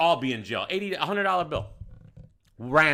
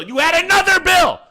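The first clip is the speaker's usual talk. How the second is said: loud, high-pitched rebuttal; indignant shouting; 12 semitones higher, 9 dB louder than usual. Retold or imitated. Manager to customer who skipped the bill, indignant shouting. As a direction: hammer the accusation home at a yell.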